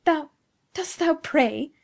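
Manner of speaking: scornful, incredulous challenge; fiendish scorn. A narrator's reading. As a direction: sneer it in disbelief, drawn out. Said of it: "still with the same fiendish scorn".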